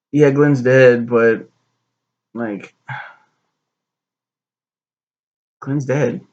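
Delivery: put-on shrugging, been-there reaction to old news; blasé. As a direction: say it like it's yesterday's news. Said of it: "This one's like".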